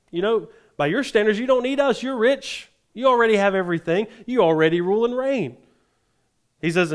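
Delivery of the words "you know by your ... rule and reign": high-pitched, mocking flattery; mock them as if they had everything; sarcastic. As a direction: sarcasm in a raised, emphatic pitch. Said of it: "Totally sarcasm. So I want you to listen to his tone".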